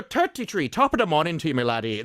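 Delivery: hammed-up lilting Limerick Irish; Irish accent. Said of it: "When people do an Irish accent".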